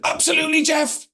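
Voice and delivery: an eager woman shouting out to the speaker; retold shout. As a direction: call out keenly from the audience. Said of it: "she shouted out".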